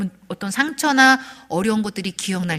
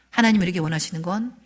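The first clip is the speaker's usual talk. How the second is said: ordinary talk, nothing marked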